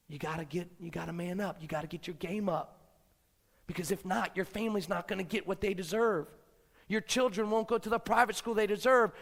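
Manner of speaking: ordinary talk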